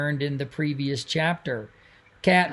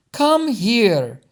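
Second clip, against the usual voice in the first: put-on loud, high, slow gushing older lady; drawn-out, loud delighted greeting like a fussy family friend; gushing Pearline; 6 semitones higher, 5 dB louder than usual. A narrator's reading. Pearline grabs Doug.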